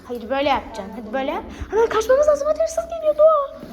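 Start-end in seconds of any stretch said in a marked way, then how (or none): 1.7-3.6 excited. Pretend. Pretend thief coming, urgent.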